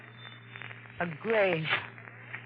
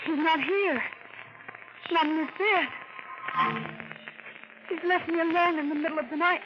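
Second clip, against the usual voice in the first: panicked; 5 semitones higher than usual. Acted. Finds husband gone at night.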